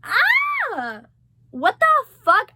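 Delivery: high, loud mock yell; mock cinema shout. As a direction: yell like a cheering crowd. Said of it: "everyone's like".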